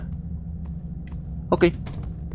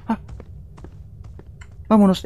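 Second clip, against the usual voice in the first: ordinary delivery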